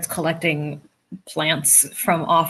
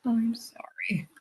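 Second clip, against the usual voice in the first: soft breathy whisper, slow; hushed condolence; whispered sympathy; 5 semitones higher, 7 dB quieter than usual. Real, not acted. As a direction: breathe the sorry out gently. Sympathy repeated, second whispered.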